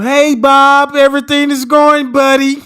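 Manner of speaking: loud, high, hammed-up friendly greeting; over-the-top neighborly shout; hearty proper guy. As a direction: boom out a cheesy businessman hello. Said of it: "I dress up in the [name]".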